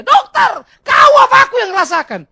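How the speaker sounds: high, full shout; patient shouting back at the doctor; hammed-up indignant yell; stubborn insistence. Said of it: "Masih ngotot dia".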